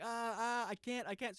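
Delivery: retold panic. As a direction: imitate a helpless, flustered driver. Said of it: "he's panicking".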